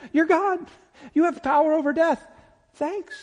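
very high, mock-overwhelmed voice; awestruck guests. Guests thanking the one with power over death, awestruck. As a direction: squeak it out, overawed and at a loss. Acts out guests awed by Jesus.

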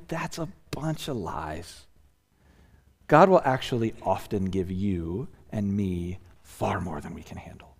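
hushed intensity. Acted. Emphatic hushed rebuttal.

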